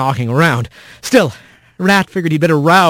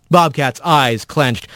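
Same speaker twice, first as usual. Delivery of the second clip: usual speaking voice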